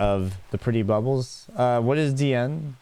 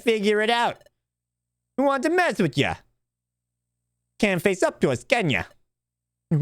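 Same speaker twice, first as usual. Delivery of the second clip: higher sneering thug voice; mock the little guy, cocky; taunting bully; 10 semitones higher than usual. Bullies steal the treasure from Elliot, higher cocky taunting.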